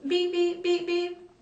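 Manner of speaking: high, even electronic beeps; high-pitched repeated beeping; imitated phone beep. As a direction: mimic call-waiting tones. Imitates phone call-waiting beep.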